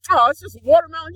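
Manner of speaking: mock realization. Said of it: "you're like".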